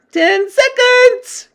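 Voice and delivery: very high, slow countdown screech; witch crying out. A narrator's reading. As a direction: call out the time like a shrieking witch. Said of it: "cried the Grand High Witch".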